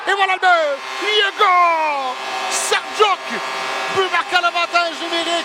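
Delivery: high, loud goal call, drawing out the scorer's name; excited sports commentary. Real, not acted. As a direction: hold the name long like a stadium roar.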